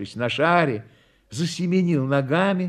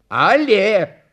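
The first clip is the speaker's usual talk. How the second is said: higher, loud circus call; command to dog; 6 semitones higher, 5 dB louder than usual. A narrator's reading. Clown urges the hiding dog, loud command. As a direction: call the cue sharply upward.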